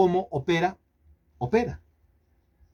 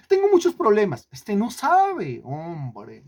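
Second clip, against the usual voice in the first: mock whining excuse; 5 semitones higher, slower than usual. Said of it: "¿Por qué no lidera una célula?".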